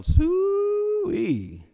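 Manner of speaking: high-pitched hog call, stretched out; Southern stadium fans yell a hog call. Retold or imitated. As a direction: call the hogs like a rowdy fan at the game. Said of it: "wear rubber pigs on their heads and yell".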